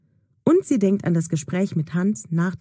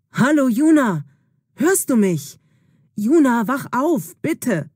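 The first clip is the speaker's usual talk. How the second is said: louder, worried calling of her name; calling urgently; 5 semitones higher, 3 dB louder than usual. Acted. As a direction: call her back to consciousness, loud and urgent. Hans wakes unconscious Juna.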